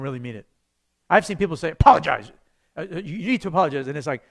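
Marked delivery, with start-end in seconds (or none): 1.7-3.9 loud, bossy order to apologize; a fast, pushy insistence; stern demand. Retold or imitated. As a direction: snap it like a parent demanding an apology.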